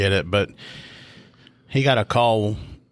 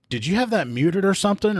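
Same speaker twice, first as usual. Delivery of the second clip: much higher, incredulous question; can't believe what he heard; stunned disbelief; 11 semitones higher than usual. Retold or imitated. Speaker's disbelief at rookie.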